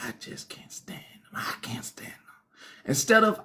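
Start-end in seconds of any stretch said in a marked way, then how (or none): 0.1-2.2 hushed, bitter under-the-breath whisper; whispered grumble. Retold or imitated. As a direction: whisper it like a secret grudge. Quoting a viewer's inner grumble.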